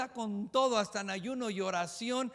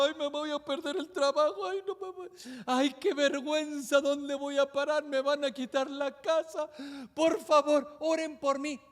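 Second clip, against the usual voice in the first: higher, whining mock worry; mock panicky believer; 3 semitones higher than usual. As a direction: whimper like someone losing everything. Preacher mocks worried believer.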